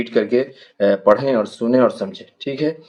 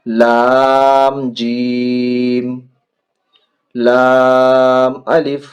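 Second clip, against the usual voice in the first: each letter name stretched long and sung out; slow letter drill; 2 semitones higher, 4 dB louder, much slower than usual. Shown as a demonstration. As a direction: chant the alphabet slowly for children. Drawn-out letter recitation drill.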